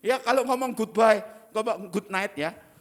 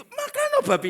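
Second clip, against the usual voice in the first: laughing; 6 dB louder than usual. Real, not acted. Joke about eating pork.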